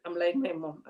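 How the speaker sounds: ordinary talk, nothing marked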